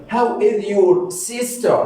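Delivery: slow, lifted language-lesson delivery; slow modelling. Shown as a demonstration. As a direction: stretch each word out for learners. Teacher models question for learners.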